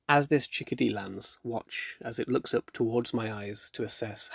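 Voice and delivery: soft, calm wildlife film voiceover; documentary narration. Acted. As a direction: narrate gently like a nature documentary.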